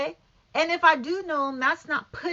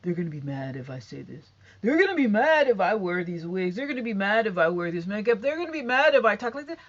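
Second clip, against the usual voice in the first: mocking timid people; 5 semitones lower than usual. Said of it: "Some of 'em like".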